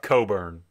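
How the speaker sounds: COBURN said loudly as KOH-burn; silent CK. Shown as a demonstration. Co-host showing how the name is pronounced, loud demonstration. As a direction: punch out Coburn with a long O and no K before the B. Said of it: "the C K is silent".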